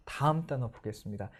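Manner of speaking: ordinary talk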